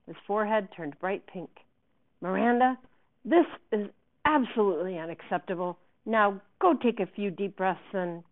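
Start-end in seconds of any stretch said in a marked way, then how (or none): 2.2-8.2 loud, outraged scolding; about to explode. A narrator's reading. Director cutting off Miranda's excuses, about to explode. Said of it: "looked like he was going to explode".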